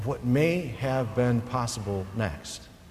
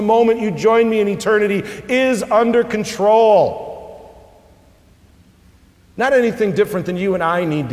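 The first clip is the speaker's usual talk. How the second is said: raised, pressing sermon voice; lifting the congregation toward comfort, preaching hard; fervent preaching; 8 semitones higher, 6 dB louder than usual. Acted. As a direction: preach it out with rising force. Voicing Jesus' promise, preached hard.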